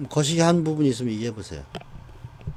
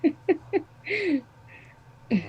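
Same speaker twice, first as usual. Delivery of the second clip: usual speaking voice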